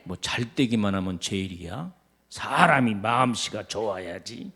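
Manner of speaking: low, soft, sour muttering; a spiteful aside about someone else's success; jealous grumbling. Acted. Preacher mimics a jealous member, low and soft. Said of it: "사울 같은 못된 시기".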